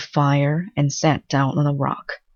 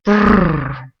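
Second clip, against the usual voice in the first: loud cold shiver through the lips; lip-trilled shivering brrr; 2 semitones higher, 6 dB louder than usual. A narrator's reading. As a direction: call out a chilly brrr as he lands in the cold pool. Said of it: "he called out".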